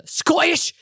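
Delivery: Scottish accent on Scottish. Shown as a demonstration. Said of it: "They have the worst accents".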